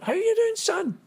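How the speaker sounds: very high-pitched mock Scottish woman's voice; Scottish mother's accent. Prisoner's Scottish ma greets the boy from Glasgow, imitated high Scottish accent. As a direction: mimic a Scottish ma in a high squeal. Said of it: "Her accent was better than mine".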